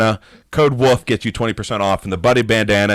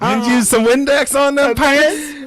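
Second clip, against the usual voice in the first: much higher, slow put-on Pittsburgh Yinzer voice; pitched-up, slow mock Yinzer flirt; Yinzer pickup line; 12 semitones higher, slower than usual. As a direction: ham up a cheesy line in a Pittsburgh accent. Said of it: "pickup line in a Pittsburgh accent".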